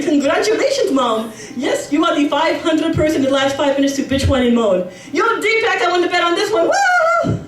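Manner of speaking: fake-cheery salesman; mocking manager. Store manager mocking the complaining customer, sarcastic cheer.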